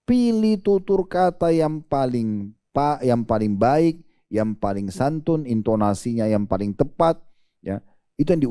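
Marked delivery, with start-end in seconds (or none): 0.1-7.2 slow deliberate emphasis. Real, not acted. Stressing the ideal way to speak.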